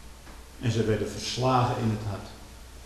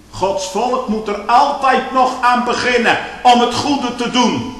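"Gods volk moet er altijd nog aan beginnen" said higher and louder; preached out loud; 10 semitones higher, 8 dB louder, slower than usual. Shown as a demonstration. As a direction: call it out to the church. Repeated phrase, then preached loudly. ("Gods volk moet er altijd nog aan beginnen" luid en hoog uitgeroepen)

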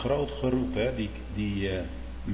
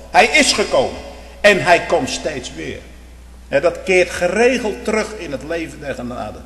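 loud, higher fervent preaching that builds to a shout; preaching at full force; 7 semitones higher, 10 dB louder than usual. Real, not acted. Preacher exhorting his congregation, preaching at full force. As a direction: thunder it from the pulpit.

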